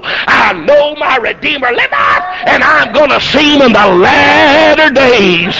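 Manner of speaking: drawn-out, high preacher's shout; triumphant crowing. Acted. Job crowing from the rail over the beaten devil, triumphant. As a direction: crow it out slowly, building to full voice. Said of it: "began to crow".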